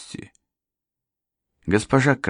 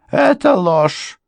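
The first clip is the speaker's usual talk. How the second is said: high, slow, loud flat denial; housekeeper's denial; 14 semitones higher, 6 dB louder, much slower than usual. A narrator's reading. Housekeeper calling the lawyer's claim a lie, high and slow. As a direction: refuse it outright. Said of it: "housekeeper denies accusation".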